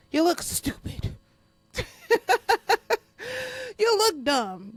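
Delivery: taunting whisper; mocking taunt. Real, not acted. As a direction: drag it out to mock them.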